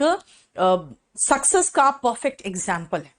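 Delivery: normal talking voice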